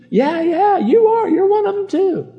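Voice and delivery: very high mock crowd voice; mob agreeing. Bystanders joining in against Peter, high-pitched accusing.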